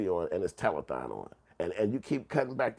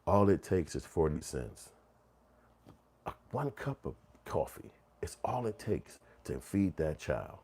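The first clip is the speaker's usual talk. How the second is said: slow, soft charity-ad narrator; lightly voiced slow pitchman; 4 semitones lower, 6 dB quieter than usual. Imitating the charity ad pitchman, slow and soft. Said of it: "used to talk slow as hell".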